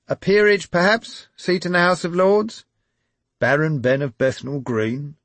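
high, loud press shout; calling out. A narrator's reading. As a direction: shout the question across. Reporters calling out.

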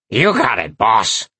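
croaky little demon servant; raspy imp voice. A narrator's reading. Demon servant answering the boss, raspy croak. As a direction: rasp it out as an eager-to-serve imp. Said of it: "croaked in its raspy, high-pitched voice".

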